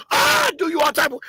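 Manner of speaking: ordinary talk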